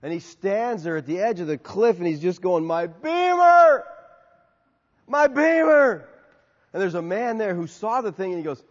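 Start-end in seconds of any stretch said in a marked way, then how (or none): 2.6-6.1 high, loud, slow wailing over the car; anguished shout. Acted. Man at the cliff edge mourning his BMW, wailing shout. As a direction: howl it like he lost everything.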